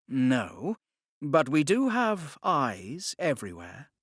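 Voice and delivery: a captive gentleman making his offer; Spencer's voice. Acted. Spencer persuading Walpole.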